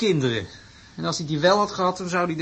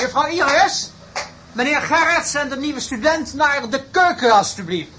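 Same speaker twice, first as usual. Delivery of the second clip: grand professor; 6 semitones higher, 5 dB louder than usual. Acted. Professor orders assistant grandly.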